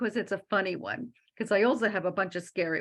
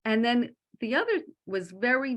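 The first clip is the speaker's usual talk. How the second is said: ordinary talk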